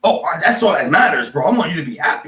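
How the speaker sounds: high-pitched, pointedly over-solicitous; mock concern. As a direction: fuss over their happiness with false sweetness. Mock concern for landlord's happiness.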